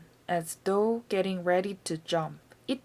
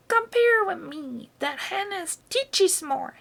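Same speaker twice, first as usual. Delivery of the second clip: put-on much higher old woman's shout; grandma shouting back; 10 semitones higher, 4 dB louder than usual. A narrator's reading. Grandma on the roof answering the boy, high shout.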